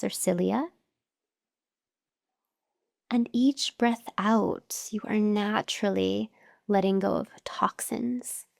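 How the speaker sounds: slow, measured meditation voice with long pauses; guided meditation. Acted. Teacher guiding listeners to notice their breath, calm meditation voice. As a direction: guide them unhurriedly through each breath, pausing between phrases.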